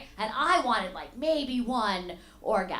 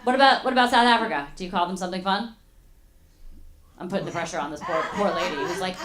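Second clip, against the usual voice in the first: ordinary talk, nothing marked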